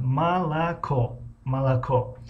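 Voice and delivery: малако said slowly as malakO, stress on the last о, earlier о's reduced to а; careful slow малако for students to repeat; slow teaching model. Shown as a demonstration. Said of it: "калимаи малако".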